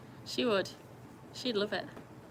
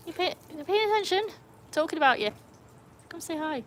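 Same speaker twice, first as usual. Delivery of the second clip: higher and louder; calling to the dog; 8 semitones higher, 6 dB louder than usual. Real, not acted. Owner calling Jack to pay attention, high and loud. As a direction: call the dog over cheerfully.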